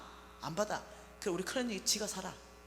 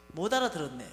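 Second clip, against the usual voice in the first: mother's flat, falling rebuke; a hint of a stern old mother; stubborn mother; 5 semitones lower, 4 dB louder than usual. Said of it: "또 이러는 거예요".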